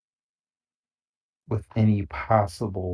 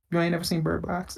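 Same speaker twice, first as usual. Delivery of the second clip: incredulous mock question; 9 semitones higher, 6 dB quieter, faster than usual. Pitching the actor to casting.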